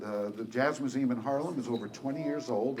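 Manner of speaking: usual speaking voice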